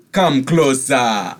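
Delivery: loud, slow beckoning call; commanding host calls out; booming call. Acted. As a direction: draw them in with a big slow summons. NPC calls guests over.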